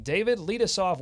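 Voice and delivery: normal talking voice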